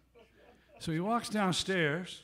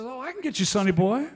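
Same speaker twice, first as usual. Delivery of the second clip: eager offer; 8 semitones higher, 9 dB louder than usual. Retold or imitated. [name] eagerly offers.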